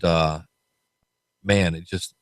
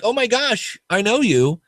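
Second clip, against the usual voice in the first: high, squealing recognition; excited drunk stranger; 10 semitones higher than usual. Retold or imitated. Tipsy woman spots him across the room, high excited squeal. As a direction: gush like a tipsy fan spotting a celebrity.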